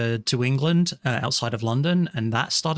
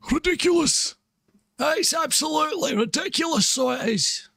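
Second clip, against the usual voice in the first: squeaky, very high complaint; high mock exasperation; 13 semitones higher than usual. Streamer griping to chat about the heat, squeaky high voice. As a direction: pitch way up in comic exasperation.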